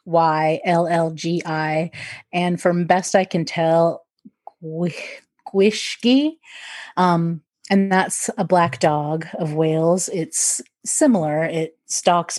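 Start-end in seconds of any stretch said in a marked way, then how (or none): 5.5-6.3 Gwishgi said as a tentative English-speaker's stab at the Welsh name; Welsh pronunciation attempt. Shown as a demonstration. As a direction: sound out Gwishgi carefully, unsure of the Welsh. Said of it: "make an attempt to pronounce it".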